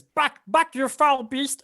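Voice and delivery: high, loud kobold bellow; Molo. Acted. Kobold orders the panther back, high defiant shout. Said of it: "he says".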